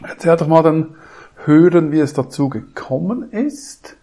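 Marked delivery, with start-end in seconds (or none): none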